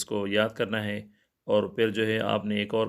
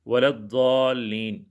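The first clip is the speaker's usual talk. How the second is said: slow drawn recitation of ولا الضالین; Quran recitation; 4 dB louder, slower than usual. Acted. Teacher recites the last verse, slow chant. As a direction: chant it slowly, as in prayer.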